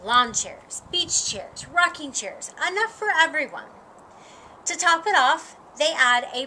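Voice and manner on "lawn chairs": ordinary delivery, nothing marked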